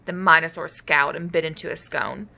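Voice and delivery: usual speaking voice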